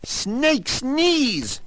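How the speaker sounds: high, rasping witch cry; cackling witch's curse; rasped. Acted. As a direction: rasp it out like an angry old witch. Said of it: "she rasped".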